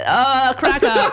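high, slow, laughing burst of mock delight; delighted squeal. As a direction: act out a baby's giggly surprise. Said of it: "we'd be like".

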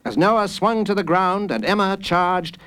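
ordinary delivery, nothing marked

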